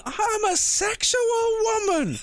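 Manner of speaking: very high, drawn-out shriek; mock top scream. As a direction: screech it slowly in hammed-up outrage. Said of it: "voice is still just at fucking top scream".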